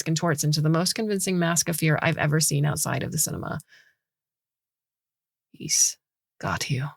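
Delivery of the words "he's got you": whispered. Acted. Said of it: "Gray whispers".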